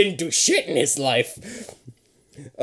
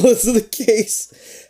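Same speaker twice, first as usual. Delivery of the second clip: ordinary delivery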